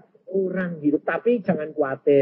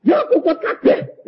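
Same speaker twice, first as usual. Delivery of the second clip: high, loud exasperated retort; raised-voice Javanese scolding; acted outburst; 11 semitones higher, 5 dB louder than usual. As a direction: exclaim it in high-pitched protest. Acted retort in retold story.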